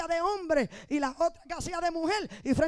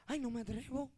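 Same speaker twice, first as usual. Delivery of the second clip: soft, lower, shrinking reply; mock a scared churchgoer; quiet mock timid whine; timid excuse; 3 semitones lower, 7 dB quieter than usual. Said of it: "gente que tú dices".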